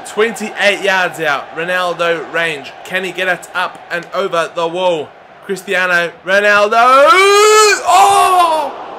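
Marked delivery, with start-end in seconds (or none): 5.4-8.8 loud call of the name, then a high laughing "oh"; excited yell. Real, not acted. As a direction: shout the shot, then gasp as it misses. Free kick just misses.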